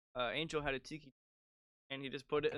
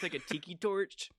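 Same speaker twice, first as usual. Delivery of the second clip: normal talking voice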